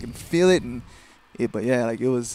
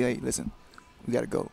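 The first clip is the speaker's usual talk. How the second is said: softer, even corner talk; same calm way; 8 dB quieter than usual. Shown as a demonstration. Fighter shows how his corner should talk, same calm way. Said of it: "talk to me the same way you do the first and the second round".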